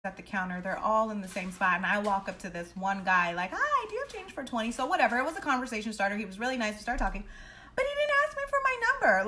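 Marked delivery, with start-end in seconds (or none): 3.5-4.7 bright, high and quick flirty hello; sweet high voice. Retold or imitated. Retelling her approach to the cute clerk, in a high sweet voice. 7.7-9.2 indignant squeal. Real, not acted. Indignant complaint.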